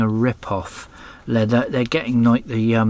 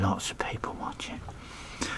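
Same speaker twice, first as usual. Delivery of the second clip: whisper; 3 semitones lower, 13 dB quieter than usual. Real, not acted. Whispered aside about viewers.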